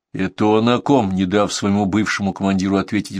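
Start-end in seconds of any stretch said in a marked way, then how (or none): none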